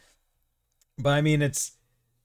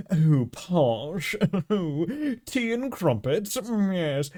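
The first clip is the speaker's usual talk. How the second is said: higher-pitched hammed-up posh British; posh British accent; 8 semitones higher, 3 dB louder, slower than usual. American parodying British tea-and-crumpets talk, posh British accent. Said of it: "two perceptions of what a British accent".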